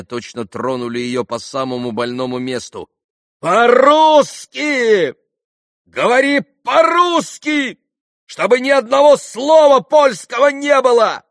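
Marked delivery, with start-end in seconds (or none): 3.4-11.2 very high, loud female-character scream; furious shouting. A narrator's reading. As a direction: lose all patience and shout the order. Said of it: "закричала она на него". (яростный крик, без всякого терпения)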